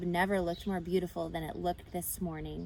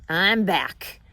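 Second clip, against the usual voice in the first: louder, worn-out husky rat; 11 dB louder than usual. A narrator's reading. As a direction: croak it out after a long night. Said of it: "he said in a husky voice".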